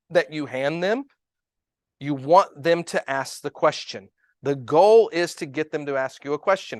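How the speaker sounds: ordinary talk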